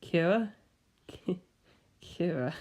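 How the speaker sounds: soft, amused menace; a killer unmasked, laughing softly; sinister quiet laugh. Acted. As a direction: chuckle as the mask slips. Kira dropping the act, laughing.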